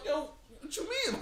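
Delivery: high-pitched, incredulous retold protest; comic high-voiced objection; mock protest. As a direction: squeal it as a playful imitation, half laughing. Acting out Miz's protest, laughing.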